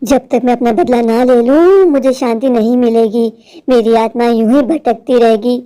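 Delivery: deep, eerie vengeful witch. Acted. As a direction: growl it like a ghost bent on revenge. (गहरी डरावनी चुड़ैल की आवाज़)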